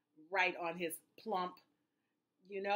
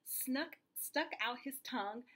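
usual speaking voice

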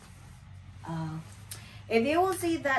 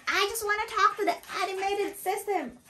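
very high, exaggerated irate whine; imitated angry customer; 3 dB louder than usual. Customer refusing to talk to the agent, mock angry. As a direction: mimic a frustrated caller, far up in pitch. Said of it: "she was so frustrated".

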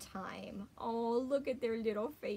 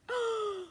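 gasp; 11 semitones higher, 3 dB louder than usual. Acted. Mock shock at Duck's plan.

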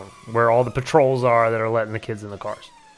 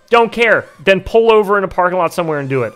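rude teacher voice; 5 semitones higher, 5 dB louder than usual. Retold or imitated.

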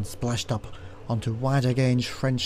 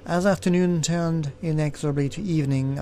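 plain reading voice

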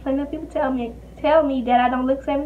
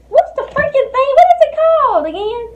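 high, loud frustrated cry; exasperated shout; 12 semitones higher, 9 dB louder than usual. Real, not acted. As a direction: yell it out in frustration. Losing her mind, can't recall.

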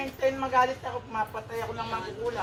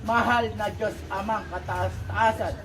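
prayer; 4 semitones lower than usual. Acted. Faith healer praying over sick Aileen, earnest prayer. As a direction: pray over the sick girl, low and steady.

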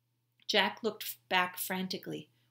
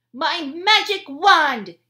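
slow grand proclamation; 10 semitones higher, 18 dB louder, slower than usual. Acted. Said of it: "Annie said".

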